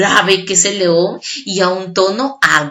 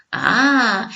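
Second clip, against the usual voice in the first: normal talking voice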